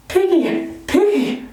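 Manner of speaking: high-pitched taunting nickname call; gleeful teasing. A narrator's reading. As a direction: chant the name high, like a playground tease. Ralph keeps teasing Piggy gleefully.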